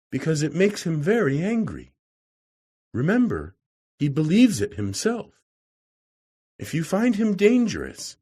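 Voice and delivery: a hint of a fretful wife warning the visitor; worried lady. A narrator's reading. Narrator voices the worried lady.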